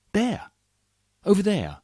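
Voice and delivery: high, loud exclamation; eager shout of discovery; excited pointing. Acted. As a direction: call out as if spotting something thrilling. Pointing out sights in the poem.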